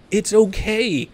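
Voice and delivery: high, light reassuring voice; mock soothing. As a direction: talk them down like it's nothing. Calming overly serious teammates.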